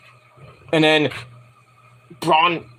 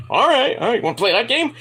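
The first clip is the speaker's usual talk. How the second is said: higher-pitched challenge; mock taunting reply; 7 semitones higher than usual. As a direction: act out a wrestler accepting the challenge. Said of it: "Braun's like".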